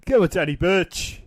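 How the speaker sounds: loud shout of encouragement; rousing cheer. Real, not acted. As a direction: urge the wrestler on at full voice. Cheering on a wrestler.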